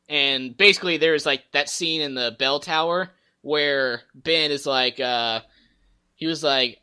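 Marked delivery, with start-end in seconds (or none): none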